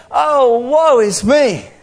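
high, very slow cry of woe; lamenting. Acted. God with a ravished heart, high drawn-out lament. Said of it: "he's also saying".

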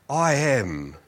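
low, slow deadpan boast; mock-solemn declaration. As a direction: drop low and declare it gravely. Mock-grave confession, punchline.

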